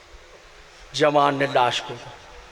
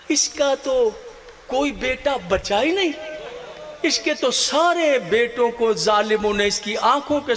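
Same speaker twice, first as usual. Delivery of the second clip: higher-pitched, bewildered protest; astonished; 8 semitones higher than usual. A narrator's reading. Tribesmen doubting that any son survived, astonished. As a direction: voice lifted in disbelief. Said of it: "हैरान होकर बनी असद ने कहा".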